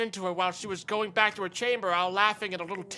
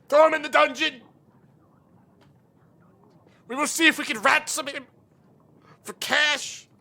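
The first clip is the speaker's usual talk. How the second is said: royal command; 8 semitones higher, 7 dB louder than usual. Acted. King ordering the bandit to the dungeon, bellowing. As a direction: bellow the order, loud and high like an angry king.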